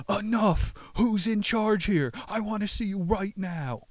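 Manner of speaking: high, loud desperate yell. Acted. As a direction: shout it out from his knees. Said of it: "and yelled".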